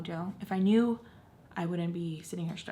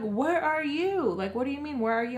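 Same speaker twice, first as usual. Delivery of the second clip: high, raised-voice retort, retold; exasperated retort; 8 semitones higher, 4 dB louder than usual. Kid arguing back at mom on the phone, exasperated.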